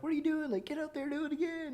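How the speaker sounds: high mock yell egging her on; shouting at her. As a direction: cheer her on in a high, hyped voice. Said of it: "we're like".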